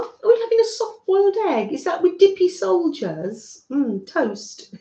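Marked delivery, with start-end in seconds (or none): 0.0-3.6 bright rising delight; excited high voice. Real, not acted. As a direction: perk right up with delight. Reading viewer comment, high and loud.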